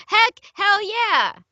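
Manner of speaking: high, slow, drawn-out hell yeah; gleeful drawn-out cheer; excited. Real, not acted. Excited swearing correction.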